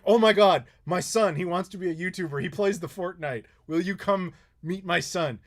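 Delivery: a thrilled father hearing his son's hero is in the car; overjoyed. Retold or imitated. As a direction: gush with excitement over the news. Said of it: "He lost his mind".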